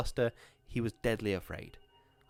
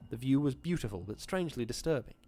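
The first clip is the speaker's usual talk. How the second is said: ordinary delivery, nothing marked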